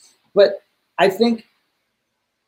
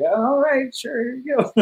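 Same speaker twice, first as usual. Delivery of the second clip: mock shrug; 9 semitones higher, slower than usual. Voicing crew's reply.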